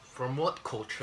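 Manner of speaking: slow, put-on UK accent; King Ross. Quoting a man who does the UK accent, mock British. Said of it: "King Ross does his accent".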